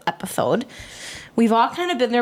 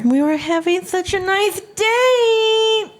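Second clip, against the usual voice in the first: straining slow and high, louder; failed high voice attempt; 11 semitones higher, 4 dB louder, much slower than usual. Copying a friend's high voice, straining slow. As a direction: try to copy the high sung line and miss. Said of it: "No, I can't".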